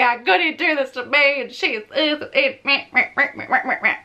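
half-joking moan about being cheated on; mock whining. Mock-whining her ex-rant.

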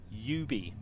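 "Ubi" starting like "you"; slow, clear YOU-bee for "Ubi"; English pronunciation. Shown as a demonstration. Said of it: "they confirmed it is pronounced".

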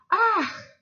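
high startled "ah"; gasped sigh. A narrator's reading. Hare's mother seeing the soaked bear, sighing aghast. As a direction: sigh out in mild shock, pitched high. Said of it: "westchnęła mama zajączka".

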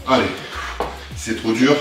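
normal talking voice

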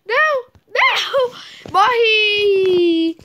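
high, loud cries of defeat; frustrated gamer outburst; dismayed yelling. Real, not acted. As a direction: yell it out, stretching the last word into a wail. Dies in the game.

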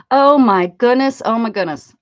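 loud, exasperated outcry after a chuckle; loud exclamation. Real, not acted. Streamer pestered for songs, loud exasperated exclamation. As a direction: burst out loudly, then ease slightly. Mock exasperation at song complaints.

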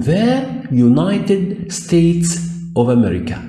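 slow, carefully spaced English; teacher dictating slowly; slow demo reading. Shown as a demonstration. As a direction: read each word out slowly for the class. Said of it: "يبقى أنا عندي كلمة state".

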